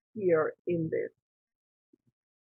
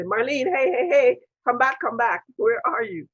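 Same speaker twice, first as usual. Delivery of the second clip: raised, louder, higher call; calling someone back; 5 semitones higher, 6 dB louder than usual. Retold or imitated. People calling the meditating speaker back, calling out loudly. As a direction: call out insistently to bring someone round.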